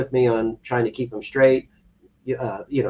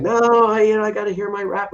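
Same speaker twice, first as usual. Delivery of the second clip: put-on high, whiny teenage protest; teen son protesting; 9 semitones higher, 3 dB louder than usual. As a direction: mimic a kid begging for his rap. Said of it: "and he'd be going".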